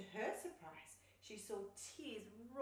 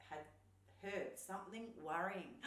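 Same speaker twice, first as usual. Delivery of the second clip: ordinary delivery, nothing marked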